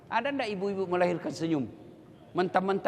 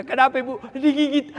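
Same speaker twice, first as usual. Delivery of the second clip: husband and wife voiced; 7 semitones higher, 7 dB louder than usual. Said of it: "Dia bilang suaminya".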